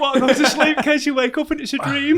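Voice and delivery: laughing. Real, not acted. Mock-romantic joke, laughing.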